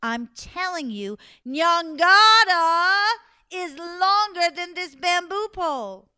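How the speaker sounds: slow, high, booming proclamation; really loudly. Acted. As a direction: stretch the name out in a big shout. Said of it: "finally said really loudly".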